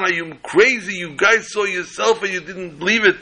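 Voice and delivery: defense attorney shouting at the jury, high and incredulous; outraged lawyer. Acted. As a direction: act out the attorney losing it.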